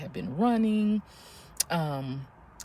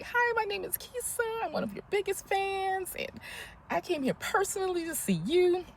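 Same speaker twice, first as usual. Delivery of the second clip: high-pitched, re-enacted starstruck introduction; gushing fan greeting; 13 semitones higher than usual. Big fan meeting the girls at last, high gushing greeting. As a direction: gush in a high voice, imitating an excited fan.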